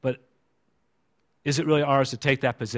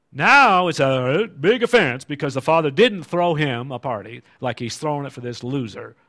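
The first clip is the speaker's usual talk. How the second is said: normal talking voice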